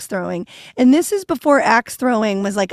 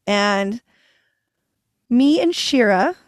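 ordinary talk, nothing marked

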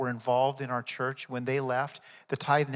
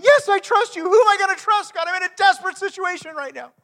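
desperate cry for help; 13 dB louder than usual. Acted. Climber dangling from a cliff cries to God, very high panicked shout. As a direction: scream it up to heaven, hanging on for life.

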